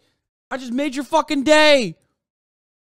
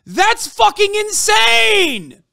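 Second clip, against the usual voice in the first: high, loud and slow, stretching each word; incredulous yell; 11 semitones higher, 5 dB louder, much slower than usual. Real, not acted. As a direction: shout it out slowly in sheer disbelief. Streamer ranting in disbelief.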